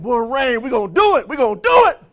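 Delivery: loud, rising pumped-up cheer; mock pumped-up cheer; excited. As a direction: hype the team up. Excited team member quoted.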